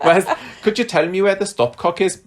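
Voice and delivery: high, laughing go at a Welsh accent; attempted Welsh accent. Shown as a demonstration. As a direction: have a giggly stab at Welsh. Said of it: "I can't ask that in a Welsh accent".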